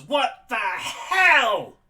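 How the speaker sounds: slow, stretched, high and loud disbelieving yell; shocked shout. Acted. Manny, drenched in cow blood, yells at the party in disbelief. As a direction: drag out a horrified shout.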